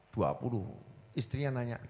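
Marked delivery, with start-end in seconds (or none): none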